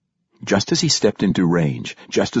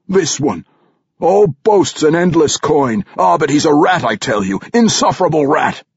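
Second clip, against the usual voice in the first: spiteful accusation; 8 semitones higher, 5 dB louder than usual. A narrator's reading. Kermelon spitefully accuses Regis.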